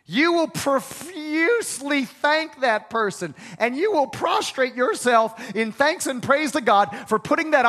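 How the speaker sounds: loud, high preacher build; full-force preaching. Acted. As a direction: drive it home to the congregation.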